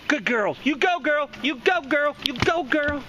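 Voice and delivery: high cheering doggy voice; pet praise. Real, not acted. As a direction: cheer the dog on. Praising the dog.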